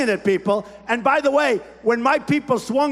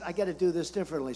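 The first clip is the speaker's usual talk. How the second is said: quiet aside; 6 semitones lower, 10 dB quieter, much faster than usual. Retold or imitated. Retold thought, said to himself.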